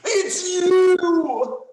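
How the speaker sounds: high, loud gasping cry; stunned hero unmasking the villain; shocked recognition. Acted. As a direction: blurt it out as the mask drops. Unmasking the fake nurse.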